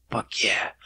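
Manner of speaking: low, hushed fake satisfaction; soft, whispery put-on pleasure; mock worked up. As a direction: breathe it out in mock arousal. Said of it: "fucking getting all worked up now".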